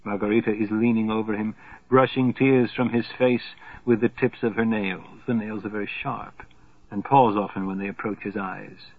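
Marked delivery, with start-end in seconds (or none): none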